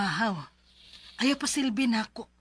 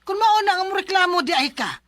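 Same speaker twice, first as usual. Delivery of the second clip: louder, higher furious scolding; angry scolding; 7 semitones higher, 8 dB louder than usual. Acted. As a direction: snap at him, threaten to throw him out. Aunt scolds complaining nephew. (kasuko nga pagbadlong)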